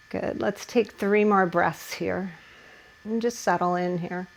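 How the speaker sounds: hushed meditative teacher voice; soft guided relaxation. Acted. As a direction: ease the class into stillness. Settling class into hip stretch.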